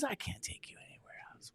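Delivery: whispered romantic aside. Pretend. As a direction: breathe it to her softly. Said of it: "in my romantic voice".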